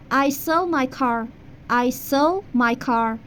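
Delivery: slow teacher demo of the sentence; slow model sentence. Shown as a demonstration. Teacher modelling English for Persian students, slow. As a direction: say it slowly for repetition. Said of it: "مثلاً".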